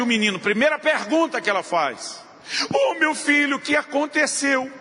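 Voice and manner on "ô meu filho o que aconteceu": high, fussing mother's voice; mother rushing to her fallen son, fussing and high; doting mother. Retold or imitated. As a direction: coo over the hurt boy like his mom.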